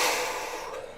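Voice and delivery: long, breathy exhale; heavy sigh. Real, not acted. Nervous trader watching the chart, heavy sigh. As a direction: let out a long tense sigh.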